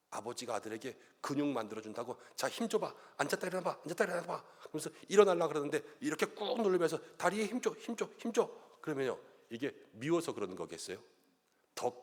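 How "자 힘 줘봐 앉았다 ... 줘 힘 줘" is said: higher, urging coach voice; father cheering on a squat; coaxing father. Retold or imitated. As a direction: push the son on, playfully. Father coaching son's muscles.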